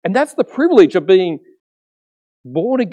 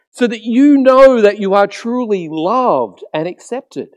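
higher, louder preaching, slowing on key words; impassioned preaching; 6 semitones higher, 4 dB louder, slower than usual. Acted. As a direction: preach it with full conviction. Preaching Jesus' words, raised.

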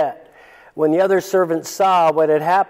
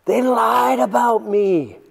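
high, slow, wounded whine to the Lord; whining complaint; 7 semitones higher than usual. Retold or imitated. Listener complains to the Lord in a high, wounded whine. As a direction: protest like someone who feels wronged. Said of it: "going where I went when I read that verse".

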